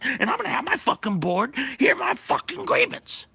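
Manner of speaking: angry Santa ranting at the union board; furious rant. Retold or imitated. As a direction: scream your grievance at the whole room.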